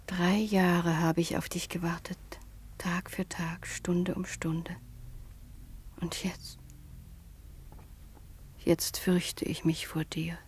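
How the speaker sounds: slow, faint unvoiced whisper; frightened whisper. Daughter confesses to her fiancé she now fears him, a frightened whisper.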